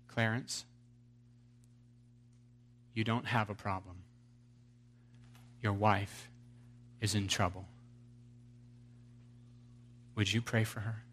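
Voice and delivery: quiet and patient, speaking as God; gentle voice of God. Acted. God answers again calmly.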